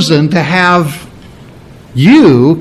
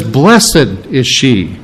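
slow quote; 4 semitones lower, much slower than usual. Shown as a demonstration. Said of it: "And she says".

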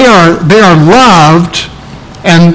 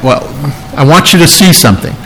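normal talking voice

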